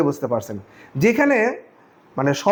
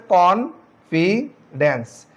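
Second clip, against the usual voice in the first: slow syllables; much slower than usual. Shown as a demonstration. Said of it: "শব্দটিকে উচ্চারণ করতে হলে".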